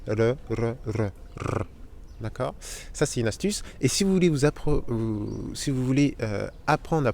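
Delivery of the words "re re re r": "re, r" from a closed L into a tongue-rolled r; tongue R drill. Shown as a demonstration. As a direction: repeat "re" with the tongue, ending in a trill. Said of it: "L que vous fermez".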